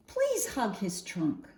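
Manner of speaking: ordinary delivery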